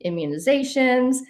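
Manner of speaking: normal talking voice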